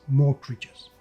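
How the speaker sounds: usual speaking voice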